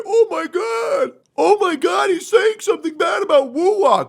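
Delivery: high, loud, mocking whine; mock an upset fan wailing; hammed-up hysterical complainer; whiny fanboy. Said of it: "be a little bitch boy and say".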